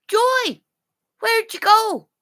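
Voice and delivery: high searching call. Real, not acted. Calling out to missing Joy.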